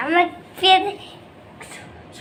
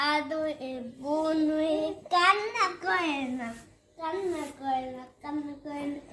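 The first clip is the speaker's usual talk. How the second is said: tender soothing; 4 semitones lower, 4 dB quieter than usual. Real, not acted. Child comforting crying baby sister.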